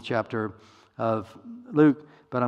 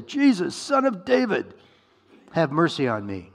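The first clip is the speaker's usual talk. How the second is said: crying out; 5 semitones higher, 4 dB louder than usual. Acted. Blind beggar to Jesus on the road, crying out. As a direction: call out over a crowd, pitch leaping up. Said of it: "he began to cry out and say".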